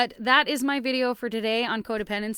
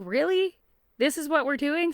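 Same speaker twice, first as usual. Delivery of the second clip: mock disbelief; 6 semitones higher than usual. Mother mocks daughter's tantrum.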